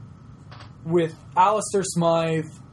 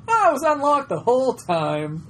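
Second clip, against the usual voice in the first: higher, louder mock discovery; surprised realization; 7 semitones higher, 4 dB louder than usual. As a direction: act amazed it was unlocked. Acted villain discovery.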